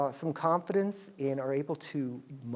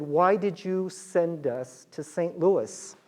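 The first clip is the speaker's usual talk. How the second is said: slow shocked question; 2 semitones higher, much slower than usual. Retold or imitated.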